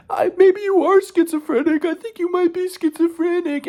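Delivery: mock-crying falsetto, slow and drawn out; Katie. Tearful girlfriend doubting his sanity, in slow mocking falsetto. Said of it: "she started to cry".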